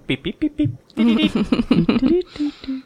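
high electronic beeps; alarm beeping. Retold or imitated. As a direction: beep like an alarm going off. Alarm stone sound imitated.

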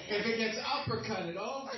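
ordinary delivery

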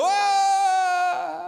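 high, loud scream as he falls; imitated falling scream. Brother jumping backwards off the cliff, screaming. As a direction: scream like you just jumped off a cliff. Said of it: "we hear him go".

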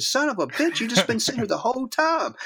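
very high-pitched astonished outburst; amazed retold exclamation. Actor discovering the author on set, amazed. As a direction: imitate an actor's incredulous squawk.

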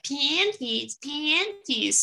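high, drawn-out taunt; teasing. Retold or imitated. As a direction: needle a friend with a hated word. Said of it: "we were teasing each other".

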